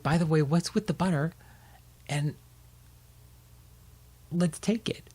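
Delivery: high, bright-voiced dad calling his pup; cheery dad. Dad finding Baxter by the tree, high and cheery. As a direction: call the little dog home, cheerfully high.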